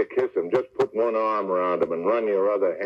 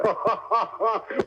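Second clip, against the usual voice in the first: amused laugh; 11 semitones higher than usual. Real, not acted. [name] laughs at Benny.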